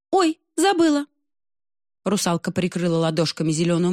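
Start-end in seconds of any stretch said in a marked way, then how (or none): 0.1-1.1 put-on high squeaky little girl voice; a startled little mermaid squeak; oops. Русалка ахает, что забыла панамку, высоким писком. Mermaid caught without hat.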